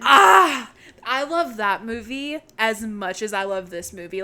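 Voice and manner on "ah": loud, higher burst of excitement; excited squeal. Real, not acted. Host hyped to watch H two O, excited squeal. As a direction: let out a thrilled shriek.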